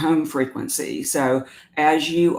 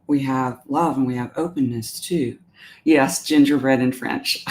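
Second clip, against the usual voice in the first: ordinary talk, nothing marked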